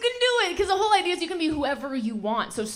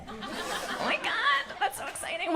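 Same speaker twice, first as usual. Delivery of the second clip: a much higher, slow mock-excited gasp; mock excited gush; 9 semitones higher, 5 dB quieter, slower than usual. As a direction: fake thrilled for them. Reacting to couple.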